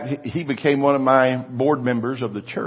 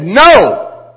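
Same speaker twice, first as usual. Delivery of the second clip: loud high cry; shouted rebuke; 10 semitones higher, 13 dB louder than usual. Retold or imitated. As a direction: shout it down with faith. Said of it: "And we said".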